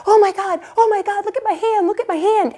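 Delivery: high, panicked mock yelp; imitating husband's high panic over his hand. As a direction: squeal it like he's freaking out.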